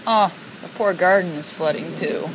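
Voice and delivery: normal talking voice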